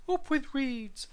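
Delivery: high, drawn-out dinosaur hoot; sing-out duckbill cheer. A narrator's reading. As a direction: hoot it out slowly, well above your own pitch. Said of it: "they hooted".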